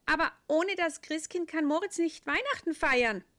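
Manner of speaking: high, loud little angel; a bright high angel. A narrator's reading. Angel character.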